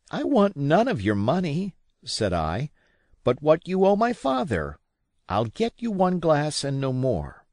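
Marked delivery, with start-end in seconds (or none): 0.1-1.7 lightly voiced boy, higher and indignant; a lad refusing a bribe, offended; young Jim standing firm, higher. A narrator's reading. 3.2-7.5 lightly voiced boy, higher and indignant; a lad refusing a bribe, offended; young Jim standing firm, higher. A narrator's reading.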